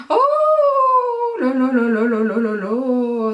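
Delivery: long drawn-out rolling exclamation; excited wail. Real, not acted. Vlogger amazed that the lash works, excited drawn-out cry. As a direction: stretch it out in giddy amazement.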